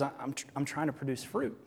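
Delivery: small strained branch voice; talking tree. Acted. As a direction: voice a struggling little branch. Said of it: "the branch says".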